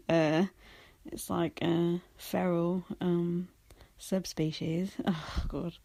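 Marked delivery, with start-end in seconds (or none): none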